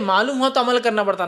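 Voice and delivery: normal talking voice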